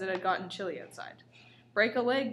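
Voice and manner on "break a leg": loud cheerful call. A narrator's reading. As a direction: call it out together. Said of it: "we all cried".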